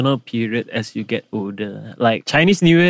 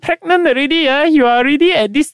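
singlish auntie. Retold or imitated. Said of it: "They will say like".

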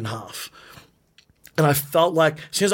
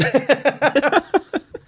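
high laugh; 12 semitones higher than usual. Real, not acted. Surprised laugh at question.

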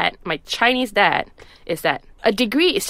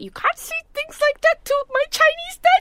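mock scolding. Retold scolding of fiancé.

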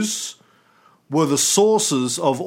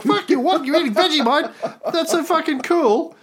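amazed fan; 12 semitones higher than usual. Retold or imitated. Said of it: "I'm like".

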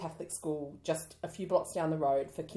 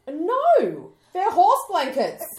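exasperated; 11 semitones higher, 11 dB louder, much slower than usual. Real, not acted. Disgust at the trend.

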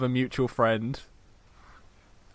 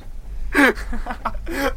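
a burst of high laughter; squealing laugh at the punchline; 12 semitones higher than usual. Real, not acted. Reacting to a funny story.

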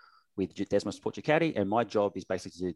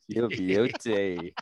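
drawn-out yo beauty; much slower than usual. Pretend. Repeats greeting drawn out.

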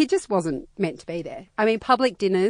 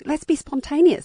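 chirpy enthusiasm; 9 semitones higher, 3 dB louder than usual. Retold or imitated. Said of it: "we go".

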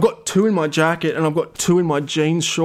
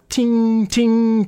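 imitated bottle clink; 7 semitones higher than usual. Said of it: "you can just hear this".

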